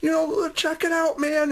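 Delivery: high, excited dad voice pointing things out; dad distracting. Father distracting his son from the needle, high and excited. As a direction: pitch way up to get a scared kid to look. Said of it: "Dad says".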